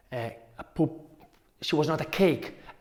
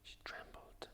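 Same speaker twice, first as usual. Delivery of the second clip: hushed whisper on she trembled; whispered trembling; 30 dB quieter than usual. A narrator's reading. Narrator hushing she trembled to scare listeners, whispered.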